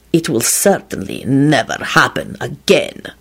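slow, cold, deliberate threat. A narrator's reading. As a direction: pronounce it slowly and coldly. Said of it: "Radu responded coldly".

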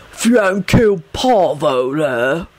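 slow, mildly hostile thug voice; slow down into a hint of menace; turning hostile. A narrator's reading. Bald man blames Sirius for the death, hostile. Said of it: "his voice turning hostile".

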